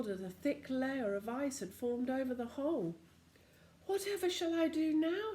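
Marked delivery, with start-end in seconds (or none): 3.9-5.3 put-on worried boy. Joe dismayed.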